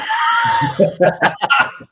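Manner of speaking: real shouted laugh at himself. Real, not acted. Laughs after the rude remark.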